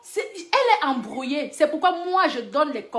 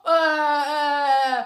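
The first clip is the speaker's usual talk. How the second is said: normal talking voice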